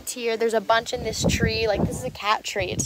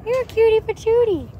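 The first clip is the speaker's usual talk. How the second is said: doting pet talk; 9 semitones higher than usual. Real, not acted. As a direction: coo over an adorable kitty. Doting on a cat.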